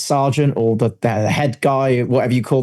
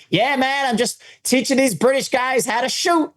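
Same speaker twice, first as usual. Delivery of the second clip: much higher put-on American voice; American gun owner; 12 semitones higher than usual. John reassures the cop about his British guests, high mock-American. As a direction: imitate a casual American, pitched way up. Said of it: "He's like".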